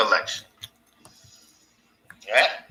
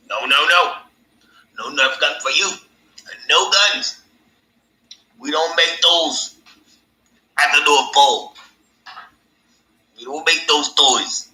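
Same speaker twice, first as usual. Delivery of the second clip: higher, lightly put-on Santa voice; mock Santa; 4 semitones higher than usual. Mock Santa turning down a boy's Nerf gun wish. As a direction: play a strict Santa refusing a kid. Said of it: "And Santa goes".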